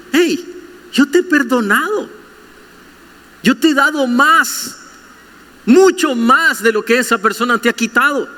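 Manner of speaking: high, earnest voice of God; lightly voiced God reminding kindly; God's gentle rebuke. God reminding the believer what he forgave, mild rebuke.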